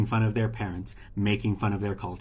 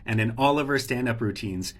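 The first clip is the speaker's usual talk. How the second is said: normal talking voice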